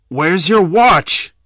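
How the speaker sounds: loud, slow menacing demand; robber's demand. A narrator's reading. Robber demanding victim's watch, slow loud threat. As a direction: draw it out like a threat.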